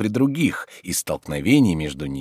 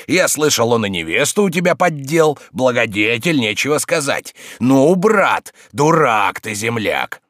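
loud, raised-pitch scolding; angry taunting; 7 semitones higher, 6 dB louder than usual. Acted. Tarantyev mocks Oblomov over his lost bride, angry taunting. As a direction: berate a foolish friend.

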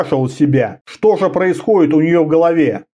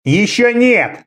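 furious retort; 6 semitones higher, slower than usual. Acted. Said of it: "возразил кемериец в ярости".

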